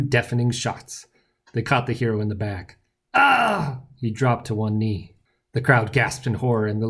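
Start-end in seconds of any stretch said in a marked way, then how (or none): 3.1-3.8 high theatrical "ah" of pain; cry of pain. A narrator's reading. Hero shot in the back, pained cry. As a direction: cry out as if hit.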